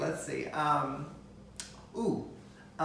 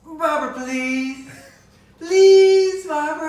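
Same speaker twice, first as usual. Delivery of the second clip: much higher, slow, half-sung pleading; Alyssa Edwards impression; 10 semitones higher, 11 dB louder, slower than usual. Showing off an impression for his partner, high dramatic plea. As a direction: plead dramatically like a drag queen. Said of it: "you're just doing Alyssa Edwards".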